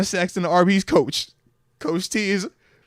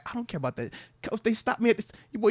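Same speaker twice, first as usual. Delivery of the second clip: normal talking voice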